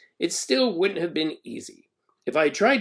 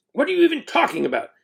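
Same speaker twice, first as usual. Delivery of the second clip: snapping; 12 semitones higher, faster than usual. A narrator's reading. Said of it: "the principal snapped at me".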